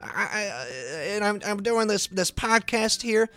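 high-pitched mocking impression of a co-host; high, stammering complainer voice; Joel Sikora. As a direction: grumble in a high put-on voice, tripping over words. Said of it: "here's my Joel Sikora impression".

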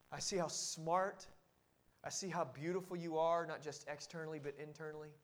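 husband softly praising his wife; tender praise. Shown as a demonstration. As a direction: tell her gently and sincerely.

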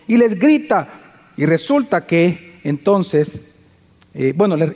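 normal talking voice

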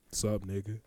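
low, soft big-man greeting; deep voice. Retold or imitated. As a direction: imitate his deep, quiet challenge. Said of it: "Like, deep ass voice".

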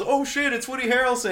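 high, loud mimicked onlooker; loud star-struck outburst; excited crowd exclamation. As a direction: shout it like a whole crowd spotting a star. Acting twenty people exclaiming.

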